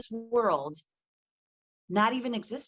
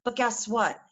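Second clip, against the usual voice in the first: usual speaking voice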